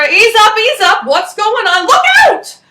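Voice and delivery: high, loud panicked cries; alarmed shouting. Acted. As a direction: yell a warning as danger rushes in. Sheep charging at the pigeons.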